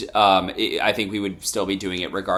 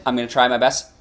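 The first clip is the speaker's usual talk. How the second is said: ordinary delivery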